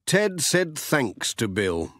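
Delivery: plain reading voice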